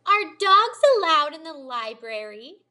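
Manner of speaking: pointed loud question. A narrator's reading. Amanda demanding pointedly.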